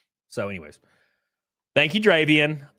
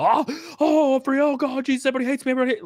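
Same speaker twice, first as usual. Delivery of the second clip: much higher, frantic mock wail; mock freak-out; 12 semitones higher, much faster than usual. Panicking friend calling people, hammed-up high wail. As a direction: ham up a panicked friend's call. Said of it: "freak out and call people".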